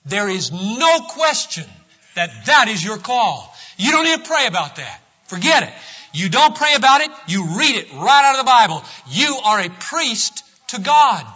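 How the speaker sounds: impassioned preacher; full-force preaching. Acted. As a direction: hammer it home to the pews.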